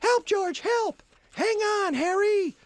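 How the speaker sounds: put-on very high child's cry for help; very high panicked kid calling out; child shouting for help. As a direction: scream like a drowning boy. Kids calling out on ice.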